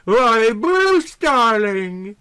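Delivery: high, loud gushing cry; cried out sweetly. Acted. Beauty crying out to Bruce, loud and gushing. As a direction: gush his name like a delighted belle. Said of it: "she cried".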